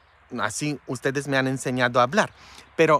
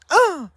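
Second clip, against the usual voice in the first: mock scandalized gasp; 8 dB louder than usual. Mocking scandalized gasp again.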